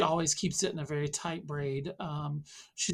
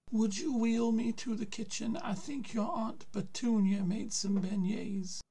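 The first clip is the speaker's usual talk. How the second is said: put-on higher, aged old man's voice; old grandpa voice; 7 semitones higher than usual. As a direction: voice the weary grandfather.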